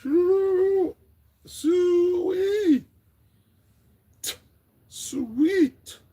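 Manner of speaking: very high loud squealed sweet; falsetto squeal. Pretend.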